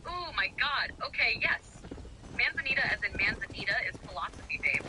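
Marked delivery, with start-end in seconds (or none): none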